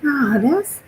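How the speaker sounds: realizing who it is, high and loud; amused disbelief. Retold or imitated. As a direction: catch him out, half laughing in disbelief. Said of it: "but I'm like".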